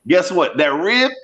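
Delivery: ordinary talk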